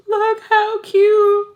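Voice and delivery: high, loud, drawn-out tearful wail; crying. Real, not acted. Vlogger shows off cute gift, crying loudly. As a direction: wail it slowly through happy tears. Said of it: "I don't know why I'm crying".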